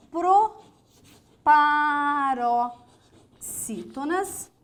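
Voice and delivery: Todas loud, proparoxítonas stretched very slow; emphatic slow repeat. Shown as a demonstration. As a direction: spell out the rule word by word. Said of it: "Todas as proparoxítonas são acentuadas". (repetindo a regra palavra por palavra, com ênfase)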